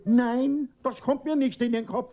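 sharp, high indignant retort; father bristles at his daughter's suspicion. Acted. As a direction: flare up and cut her off.

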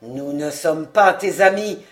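low threatening voice; low and menacing. Acted. Bandit threatening the captive, low menace. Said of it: "d'une voix basse et menaçante".

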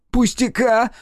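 very high, loud indignant echo; fly up at the word; outraged shriek. A narrator's reading. Nikitin outraged at Karmushin's dismissal, shriek. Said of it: "совсем уж взвился Никитин".

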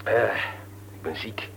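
unvoiced, weak sick whisper; husband moaning to his wife that he is ill; 1 sickly whimper. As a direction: play the poor invalid, barely voiced. Husband acting ill, whining.

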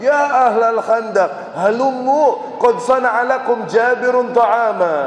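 slow, loud, high call across the camp. Retold or imitated. Prophet calling the trench people, loud slow call. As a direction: announce to the whole trench. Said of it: "dia teriak sekenceng-kencengnya".

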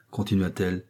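ordinary delivery

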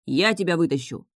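plain reading voice

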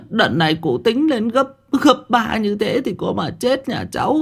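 put-on very high, wailing old woman, halting and stammering; desperate pleading. A narrator's reading. Kneeling old woman begging the official to recount, desperate high pleading. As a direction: beg piteously in a thin high wail.

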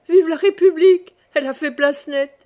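high, loud, laughing cheer; gleeful cry. A narrator's reading. Old wife cheering the Republic to her husband, loud and gleeful. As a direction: crow it out with girlish glee. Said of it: "elle ajouta avec un rire de gamine".

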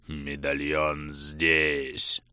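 slow, hoarse monster voice; inhuman rasp. A narrator's reading. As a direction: rasp it out like a beast. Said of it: "нечеловечьим голосом прохрипел Грим".

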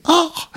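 very high, loud startled "Ach"; a sudden shriek of fright; wife caught at the mirror, loud high shriek; startled cry. A narrator's reading. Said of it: "schrie sie".

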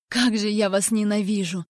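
usual speaking voice

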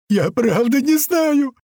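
very high, loud, drawn-out wail; tearful yell. A narrator's reading. Shot captive to his tormentor, tearful yell. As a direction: wail it slowly, choking on tears. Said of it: "Захлёбываясь соплями, слюнями и слезами".

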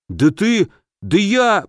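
sputter, too outraged to finish; sputtering anger. A narrator's reading. Guard insulted at the gate, angry sputter. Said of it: "покраснел от гнева воин".

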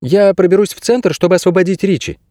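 put-on high-pitched young heroine; voiced girl character. A narrator's reading. Narrator voices the girl Kase.

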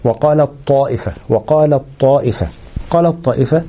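قالت طائفة fused with no nasal hum; complete merging. Shown as a demonstration. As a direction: say وقالت طائفة as "qaalat-taa'ifa", one heavy doubled t. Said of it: "أدغمنا التاء في الطاء إدغام كامل".

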